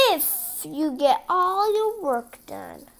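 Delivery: slow, put-on scheming voice; sly stepmother. Stepmother adds the sly condition, slow and scheming. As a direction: drag out the catch. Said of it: "she said slyly".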